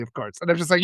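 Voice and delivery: ordinary talk, nothing marked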